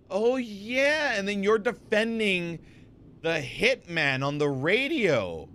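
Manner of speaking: high, drawn-out "oh yeah" of remembering; dawning realisation. Real, not acted. Streamer suddenly recalling the hitman case, dawning realisation. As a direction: stretch it out as it comes back to you. Slowly remembering the case's twist.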